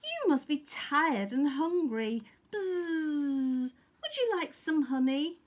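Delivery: high-pitched, buzzing bee voice; bee friends. A narrator's reading. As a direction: offer it kindly as a fellow bee. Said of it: "said Honeybee's friends".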